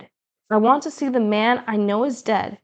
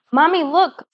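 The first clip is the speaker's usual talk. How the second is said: higher, louder call to mother; 7 semitones higher, 5 dB louder than usual. A narrator's reading. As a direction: call urgently for your mother's attention. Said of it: "I call out, gasping".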